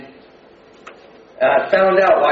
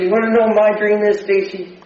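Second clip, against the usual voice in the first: higher, louder, fast frustrated retort; 7 semitones higher, 3 dB louder than usual. Retold or imitated. Pushed too far by Stacy, a frustrated fast outburst. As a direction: snap back at her, fed up. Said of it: "I got frustrated at her".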